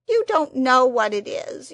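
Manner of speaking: loud, tearful but steadier. A narrator's reading. The Psammead pleads with Anthea, before cracking. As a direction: plead tearfully, still holding together. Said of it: "Its voice cracked with emotion".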